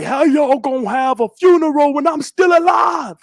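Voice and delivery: high, loud mock outburst; imitating angry friend. Friend retold yelling at his own funeral, high and loud. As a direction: shout it like an outraged friend. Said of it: "he was pretty pissed".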